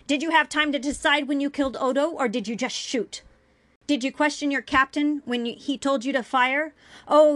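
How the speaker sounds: heated, forceful rant; yelling. A narrator's reading. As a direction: hurl accusing questions at full voice. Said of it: "she yelled".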